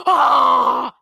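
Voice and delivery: loud, sustained hammed-up scream; mock scream. Actress as worms come down, loud mock scream. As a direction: imitate an actress overdoing a scream. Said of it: "And she's screaming".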